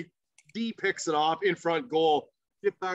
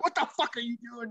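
high, quick mock outrage; retold angry challenge, pitched up and held back in volume; angry opponents; 6 semitones higher, 5 dB quieter, faster than usual. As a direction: imitate indignant players without shouting. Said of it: "a lot of angry faces".

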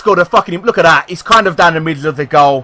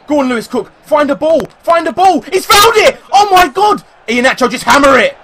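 climbing higher and louder with the chance; rising excited shout; 9 semitones higher, 6 dB louder than usual. Real, not acted. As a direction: build the yell as the ball arrives. Cross into the box.

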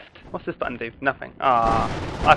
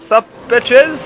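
loud greeting; 7 semitones higher, 5 dB louder than usual. Acted. Greeting enemies in game.

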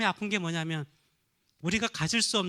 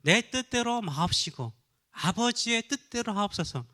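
slow, higher, reverent recitation of Jesus' prayer; solemn prayer quote; 5 semitones higher than usual. Retold or imitated. Pastor quoting Jesus' Gethsemane prayer to the congregation, slow and reverent. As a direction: intone it slowly like a sacred prayer. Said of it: "겟세마네 동산에서 하신 기도의 모습".

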